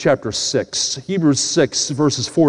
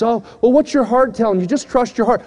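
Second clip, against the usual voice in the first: higher-pitched mock romantic counsel; imitating people's advice; 6 semitones higher than usual. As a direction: mimic someone giving easy love advice. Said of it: "ever heard people say".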